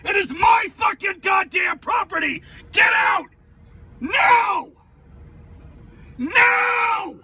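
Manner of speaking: berserk rage. Real, not acted. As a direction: bark each word at her. Driver screams at passenger.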